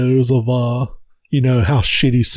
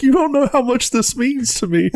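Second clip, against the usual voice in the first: high, laughing fake sob; mock crying; 10 semitones higher than usual. Worker thanking the CEO through mock tears. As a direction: ham up tears of joy. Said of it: "starts crying".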